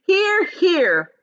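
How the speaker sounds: high, loud chorus cheer; shouting. A narrator's reading. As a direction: agree at the top of your voice. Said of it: "they all shouted".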